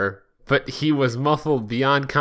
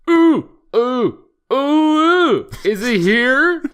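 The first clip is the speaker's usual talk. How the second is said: very high, loud excited squealing; giggly Knuckles; 12 semitones higher, 6 dB louder, much slower than usual. Acted. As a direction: squeal like a giddy kid. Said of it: "Knuckles giggled".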